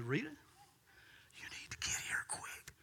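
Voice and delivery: soft, slow stage whisper; urgent whisper. Retold or imitated. Pastor retelling the hushed call, whisper. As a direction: whisper it like a secret summons.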